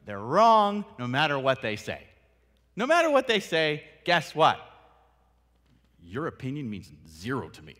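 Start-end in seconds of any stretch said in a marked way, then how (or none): 0.1-2.0 mock petulant dismissal; dismissive. Mocking a closed-minded listener. 2.7-4.6 high, loud scornful voice; mock petulant dismissal; dismissive. Mocking a closed-minded listener.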